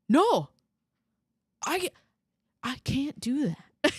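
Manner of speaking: ordinary talk, nothing marked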